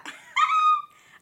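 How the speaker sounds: loud high-pitched laugh. Real, not acted. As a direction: burst out laughing at your own slip. Laughs at own forgetting.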